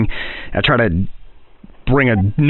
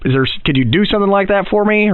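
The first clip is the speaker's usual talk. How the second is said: usual speaking voice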